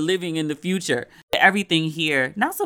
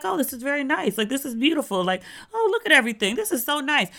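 higher, overly sweet polite praise; gushing with fake politeness; mock polite guest; 9 semitones higher than usual. Middle East dignitaries politely praising American riches, sugary mock politeness. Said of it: "they would be like".

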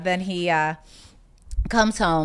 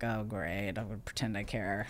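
low and much softer, deadpan sarcasm; sarcastic inner mutter; 9 semitones lower, 13 dB quieter than usual. Retold or imitated. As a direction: mutter it flat to yourself, unimpressed. Inner reaction to unwanted gift.

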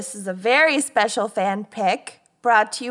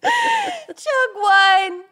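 laughing; 13 semitones higher than usual. Real, not acted. Intro, laughing through line.

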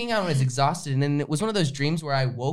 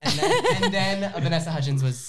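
laughing; 5 semitones higher than usual. Real, not acted. Punchline of dream story.

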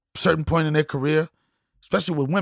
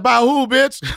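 high, loud incredulous yell; mock outraged shout; 8 semitones higher, 5 dB louder than usual. To his lady on hearing she's pregnant, mock outraged shout. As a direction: shout it like he can't believe it's his. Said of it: "I was like".

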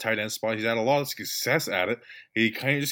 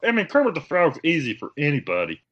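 put-on puppet frog voice, raised pitch; Kermit the Frog; 4 semitones higher than usual. Co-host shows off on request, Kermit the Frog impression. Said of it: "Here's his impersonation of Kermit the Frog".

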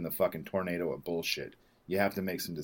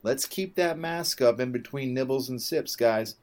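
mock flight attendant; 6 semitones higher, 3 dB louder, slower than usual. Mocking the flight attendant's announcement.

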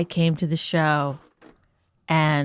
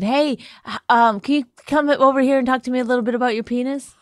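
higher, louder, sarcastic pretend invitation; sarcastic mock request; 6 semitones higher, 5 dB louder than usual. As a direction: put on a mock-eager ask, pitched up. Sarcastic made-up quote of himself.

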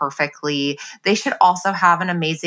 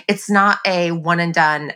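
usual speaking voice

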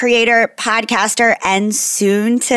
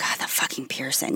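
under-the-breath whispered curse; hushed grumble at the mirror; 9 semitones lower, 14 dB quieter than usual. Retold or imitated. Muttering at mirror daily.

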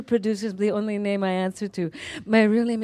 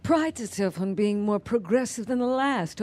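normal talking voice

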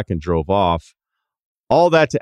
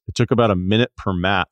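normal talking voice

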